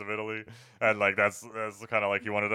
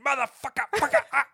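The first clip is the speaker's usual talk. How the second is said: much higher, loud shouted curses; mock movie yell; 6 dB louder than usual. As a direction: explode on cue like an actor in the take.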